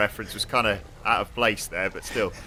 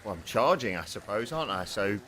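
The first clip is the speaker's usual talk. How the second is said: usual speaking voice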